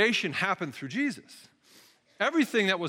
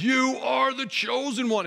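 slow, higher, grand announcement; dramatic judge; 7 semitones higher, 3 dB louder, slower than usual. Retold or imitated. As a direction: crown her like a TV judge. Judge hits the golden buzzer.

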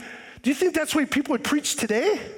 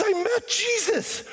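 Paul's testimony; 8 semitones higher than usual. Retold or imitated. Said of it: "Paul goes".

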